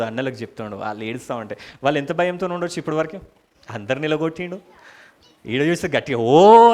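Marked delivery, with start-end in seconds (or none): 6.2-6.7 big, drawn-out high "oh"; loud wailing cry. Acted. Joseph crying aloud before his frightened brothers, a loud wail. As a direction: let out one long, loud howl. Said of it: "అని గట్టిగా అరిచిండు".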